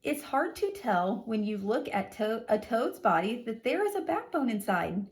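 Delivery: normal talking voice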